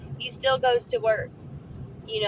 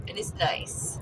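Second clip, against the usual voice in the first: soft whisper; 4 semitones lower, 6 dB quieter than usual. Real, not acted. Quiet aside while driving.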